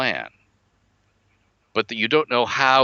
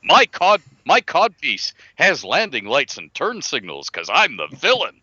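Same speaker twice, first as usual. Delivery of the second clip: mustache twirling villain; 7 semitones higher than usual. Pretend. Mocking a cartoon villain.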